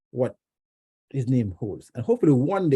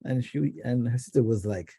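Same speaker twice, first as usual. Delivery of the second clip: ordinary talk, nothing marked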